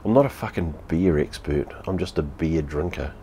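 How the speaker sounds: ordinary talk, nothing marked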